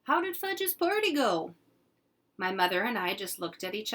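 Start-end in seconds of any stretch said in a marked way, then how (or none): 0.0-1.5 much higher, louder dad; a dad coming home cheerfully; cheerful father. Acted. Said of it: "he said".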